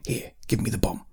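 urgent hushed whisper. Acted. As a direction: whisper so the marauders can't hear. Trubernicus hides from the marauders.

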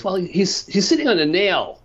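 put-on joke-telling old man, high voice; lightly voiced old man. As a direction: lightly act out the old man's high-pitched answer. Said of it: "the old man goes".

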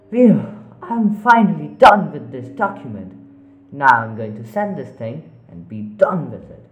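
loud, high relief; relieved exclaim. Acted. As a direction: burst out with relief. Relief after finishing work.

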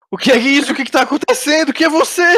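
panicked prisoner just woken; startled cultist. Acted. GM voices confused waking cultist.

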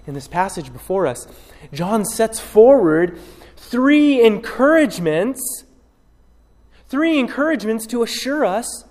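ordinary delivery

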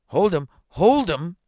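high, loud cry for help; urgent shout. A narrator's reading. Man chasing a dog that bit him, urgent shout.

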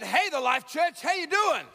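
shouted greeting, pitch climbing high on doing. Real, not acted. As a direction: call it out again, bigger, to wake the room. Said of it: "I said".

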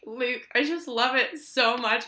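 imitating tearful gushing. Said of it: "The other day I cried over chocolate".

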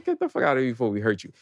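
low, quick, quiet bro warning; typical bro. Retold or imitated. Wolf pack bro warns the girl off, low mock menace. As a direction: mutter a fast threat like a bro. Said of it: "in typical bro fashion".

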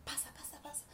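pasa pasa en susurro. Retold or imitated. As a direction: invita a pasar susurrando. Susurra invitando a pasar.

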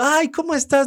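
high, loud, put-on friendly hello; mock-delighted hello; effusive greeting. Acts out his polite greeting.

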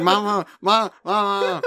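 high, mock-frantic calling of Mom; a friend mimicking Michael shouting for his mom, high and excited; imitating excited stutter. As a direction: tease him by aping his frantic calls. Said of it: "He stuttered just that much".

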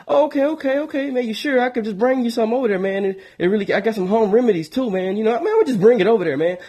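a chatty friend who wants to come over; eager friend calling. Retold or imitated. Caller voiced in phone story.

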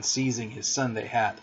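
ordinary delivery, nothing marked

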